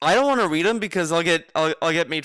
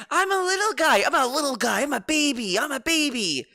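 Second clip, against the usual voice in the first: put-on much higher whiny baby voice; mock baby voice; 10 semitones higher than usual. Co-host mocking the interview, higher whiny baby voice. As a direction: whine it like a mocked baby. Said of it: "the whole interview".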